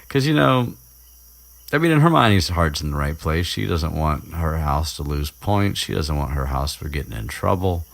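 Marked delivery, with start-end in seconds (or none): none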